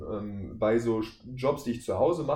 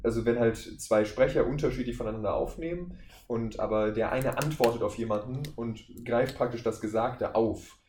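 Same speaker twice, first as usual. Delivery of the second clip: ordinary talk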